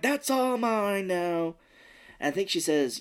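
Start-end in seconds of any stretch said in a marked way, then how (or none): 0.0-1.6 high, slow, greedy gloat; gloating Yubaba. Retold or imitated. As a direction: savour the words like a miser. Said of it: "And Yubaba's like".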